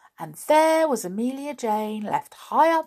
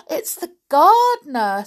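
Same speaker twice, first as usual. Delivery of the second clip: higher, louder gasp of alarm; dismay; 10 semitones higher, 10 dB louder than usual. A narrator's reading. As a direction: blurt it in sudden panic. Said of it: "said Amelia Jane in dismay".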